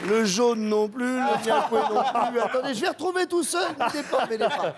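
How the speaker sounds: talking through real laughter; words dragged out, then rushed, through laughter; laughing fit. Real, not acted. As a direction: try to recover while still cracking up. Helpless laughing while trying to recover.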